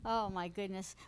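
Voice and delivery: usual speaking voice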